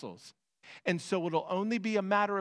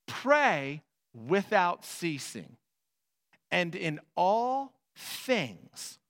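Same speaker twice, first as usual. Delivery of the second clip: slow scripture proclamation; much slower than usual. Acted. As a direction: let every word land slowly. Preaching scripture slowly.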